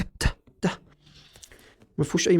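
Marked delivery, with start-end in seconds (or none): 0.0-0.8 voiceless ت, airy and separate; bare T sound. Shown as a demonstration. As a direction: release each ت as a soft puff. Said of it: "هو نفس ت". (انطق «ت» لوحدها بنفخة)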